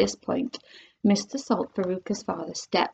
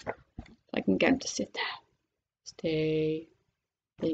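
hushed aside; 7 semitones lower, 11 dB quieter than usual. Real, not acted. Quieting the toy dragon to sit.

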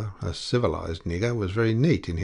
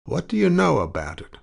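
suspicious; 9 semitones higher, 5 dB louder than usual. A narrator's reading. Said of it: "with sudden suspicion".

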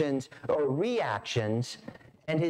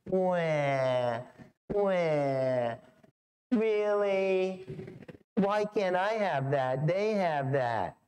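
mock childish whine, wah wah; lightly hammed-up kid complaining; whiny kid; 3 semitones higher, much slower than usual. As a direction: whine it like a sulking child who wants a toy. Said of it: "the way our kids talk sometimes".

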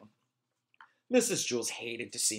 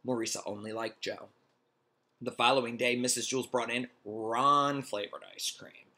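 ordinary delivery

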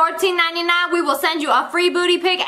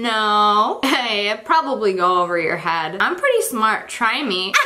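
ordinary talk

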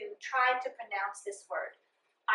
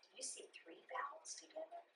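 whisper; 5 semitones higher, 23 dB quieter than usual. Real, not acted. Speaks quietly while writing.

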